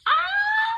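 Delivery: very high joyful scream; short high squeal; delighted shriek. Real, not acted. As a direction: scream with glee. Seeing bow.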